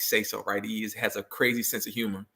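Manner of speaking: ordinary talk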